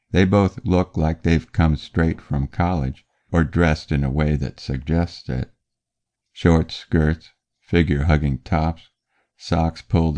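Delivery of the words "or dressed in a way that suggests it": plain reading voice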